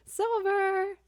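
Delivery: loud, high, thrilled call; excited shout. Real, not acted. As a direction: shout the word with delight. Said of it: "More like amazing silver".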